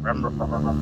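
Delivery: low rumbling engine imitation; low mock engine rum rum; motorbike noise. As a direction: rev it like a passing motorbike.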